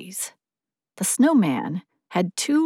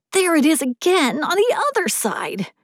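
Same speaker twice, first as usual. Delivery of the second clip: high, eager snowman voice; a wide-eyed little snowman, pitched up; chattering melting snowman voice; 9 semitones higher than usual. A narrator's reading. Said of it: "said the snowman".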